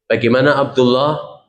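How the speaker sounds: ordinary talk, nothing marked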